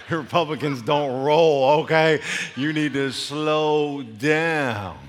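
loud, drawn-out scolding; slow, forceful correction; emphatic slow coach. Retold or imitated. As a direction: imitate a coach stretching each word firmly. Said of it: "She says".

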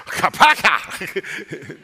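explosive comic smack sound; imitated punch noise. Shown as a demonstration. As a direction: spit out a cartoon blow, breaking into a laugh. Imitates God striking cheekbone.

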